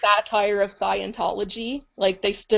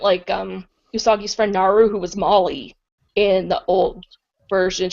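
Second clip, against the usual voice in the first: normal talking voice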